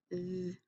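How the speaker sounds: "th" as the soft breathy th sound, no vowel; isolated "th" phoneme, very soft. Shown as a demonstration. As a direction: sound out "th" alone. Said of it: "digraph T H".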